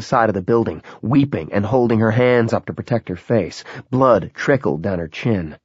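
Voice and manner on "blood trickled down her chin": ordinary delivery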